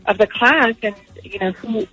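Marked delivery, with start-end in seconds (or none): none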